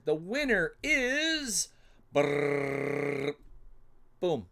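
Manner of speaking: mock announcer revealing the winner; announcer drumroll. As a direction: announce it like a game-show host.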